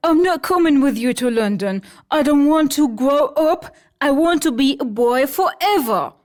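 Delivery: insist, refusing to grow up; serious Peter. Acted. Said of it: "He is very serious".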